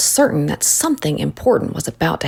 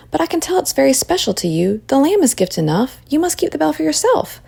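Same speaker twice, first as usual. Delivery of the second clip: higher, gentle character voice; Mary, kindly; 7 semitones higher than usual. A narrator's reading. Mary tells Rachel to keep the bell, kindly and high. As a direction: lift the voice high to reassure a child.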